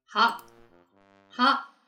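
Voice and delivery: usual speaking voice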